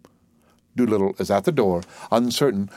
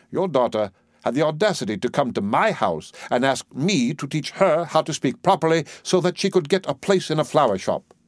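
mildly raised, accusing professor; indignant Higgins; 7 semitones higher than usual. Acted. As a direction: press the accusation, a touch outraged.

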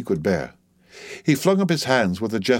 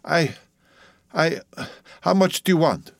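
halting, higher-pitched stammer; anxious stammer, pitched up; 7 semitones higher than usual. A narrator's reading. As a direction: stumble on the first word, with long pauses. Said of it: "Yorgos stammered".

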